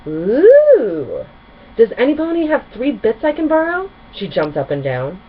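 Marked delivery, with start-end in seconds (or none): 0.1-3.9 high, loud eager squeal; excited. A narrator's reading. Pinkie Pie begging friends for bits, excitedly high. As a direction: bounce with glee begging for coins. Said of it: "looked around excitedly".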